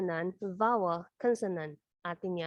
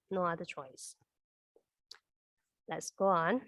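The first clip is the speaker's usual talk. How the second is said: ordinary talk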